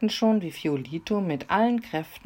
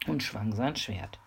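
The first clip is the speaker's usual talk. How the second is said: ordinary delivery, nothing marked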